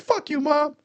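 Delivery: high, mock-snotty kid voice yelling at mom; bratty kid. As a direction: play the rude kid swearing at his mother.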